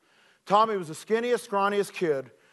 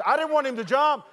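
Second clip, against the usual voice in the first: ordinary talk, nothing marked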